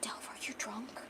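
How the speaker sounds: soft unvoiced whisper. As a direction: whisper it, barely a breath. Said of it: "And he whispers".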